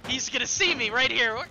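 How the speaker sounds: high, very loud alarm; panicked excitement. Real, not acted. Gamer to herself as killer nears, panicked excitement. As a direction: yell it out, sure of being spotted. Tense hiding moment in game.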